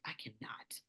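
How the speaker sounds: whispered, barely audible; defeated whisper. Retold or imitated. Recalling failing to make sweet tea, in a defeated whisper. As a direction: breathe it out in defeat. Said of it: "I remember being like".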